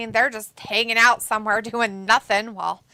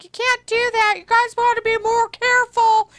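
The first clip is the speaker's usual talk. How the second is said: mock referee scolding; 10 semitones higher, 3 dB louder than usual. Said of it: "The ref's like".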